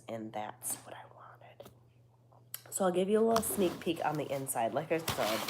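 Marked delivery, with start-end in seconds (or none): 0.1-1.7 whisper. Real, not acted. Whispered aside.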